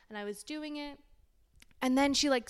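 ordinary talk

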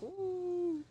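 high, soft, held "ooh"; imitated dazed "ooh". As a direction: float a long soft ooh. Imitates being still high.

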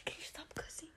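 soft unvoiced whisper. Real, not acted. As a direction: whisper the request. Whispered request.

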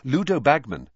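usual speaking voice

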